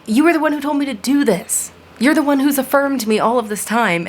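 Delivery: high, pushed, frustrated comeback; exasperated retort. Retold or imitated. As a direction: throw it back at them, exasperated. Her retort to the critic.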